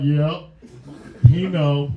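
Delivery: a low knowing grunt of a reply; mock low reply. Imitating a tall guy agreeing, low and deadpan. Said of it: "He's like".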